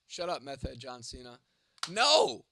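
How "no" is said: loud, high yell; one big, high-pitched shout; shouted refusal. Real, not acted. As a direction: holler it back in defiance. Refusing a chatter's order to shut up.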